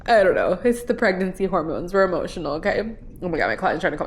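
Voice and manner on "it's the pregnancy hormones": ordinary talk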